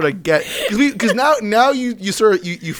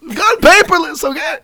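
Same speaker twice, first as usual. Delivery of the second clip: higher, laughing Seinfeld-style bit; Jerry Seinfeld impression; 11 semitones higher, 5 dB louder than usual. Host mocking paperless office as Jerry. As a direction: play up the stand-up whine, half laughing. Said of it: "It's kind of a terrible Jerry".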